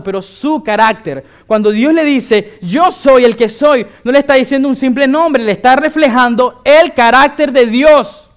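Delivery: emphatic pulpit delivery; impassioned preaching. Acted.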